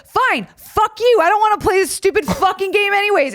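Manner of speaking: high, hammed-up defiant snap; teen defiance. Quitting teen yelling back at coach, mock angry. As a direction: fire back like a furious teenager. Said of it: "And I was like".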